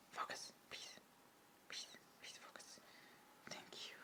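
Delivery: whisper, begging the camera; hushed coaxing to the camera; whispered pleading. Real, not acted. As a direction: plead softly under your breath.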